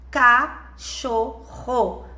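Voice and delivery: "cachorro" very slowly as ca, cho, rro; syllable by syllable. Shown as a demonstration. Teacher modelling three syllables for a child, slowly separated. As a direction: split "cachorro" into three long, separate beats. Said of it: "abre a boquinha três vezes".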